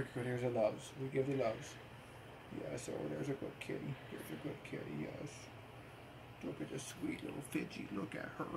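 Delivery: gentle cooing to a pet. Real, not acted. Soothing cat after costume.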